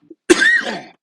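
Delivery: sharp real cough; a sudden loud cough. Real, not acted. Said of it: "Pardon me".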